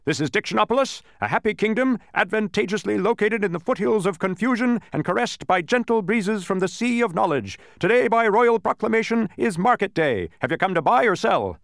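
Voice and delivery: high, forceful town-crier recital; gateman's official proclamation. A narrator's reading. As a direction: rattle off the welcome like a herald. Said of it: "snapping smartly to attention".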